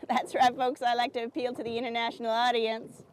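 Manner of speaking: ordinary delivery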